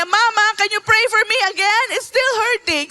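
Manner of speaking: put-on high, fast, loud pleading child; a pleading granddaughter; child pleading again. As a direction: beg like a little kid in pain. Child asks again, still hurting.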